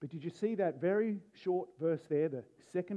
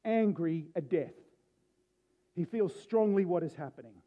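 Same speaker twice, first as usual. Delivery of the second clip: ordinary talk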